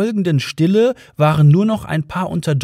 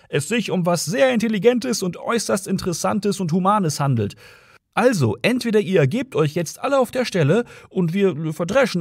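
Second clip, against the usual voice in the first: higher-pitched, mildly yelled ultimatum; shouting policeman; 6 semitones higher than usual. A narrator's reading. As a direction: lecture and threaten from afar. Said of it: "schrie der Polizist".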